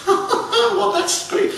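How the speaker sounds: high laugh through the words; laughing old lady. A narrator's reading. Old lady laughing at the king's polite request, high giggle. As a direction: let the laugh break the line.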